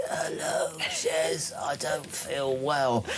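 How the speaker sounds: slow, whispery sick voice; barely manage the words, poorly; sicky voice. Pretend. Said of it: "What's your best sicky?".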